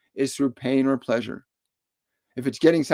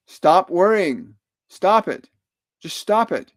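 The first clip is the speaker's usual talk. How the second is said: loud, firm, higher commands; blunt order; 5 semitones higher, 5 dB louder, slower than usual. Retold or imitated. The cousin's message passed on, bluntly commanding. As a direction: tell him flatly to quit. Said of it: "Pretty blunt".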